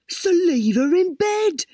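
a hint of a scheming husband; Dwayne's voice. A narrator's reading. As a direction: talk it through like the husband. Dwayne's voice in the story.

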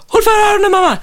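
high, loud frightened cry; child's plea. Retold or imitated. Frightened son begs mom to cover his ears, high and urgent. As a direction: cry out like a scared kid in the cinema.

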